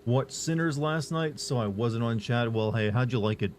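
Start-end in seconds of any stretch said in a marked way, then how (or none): none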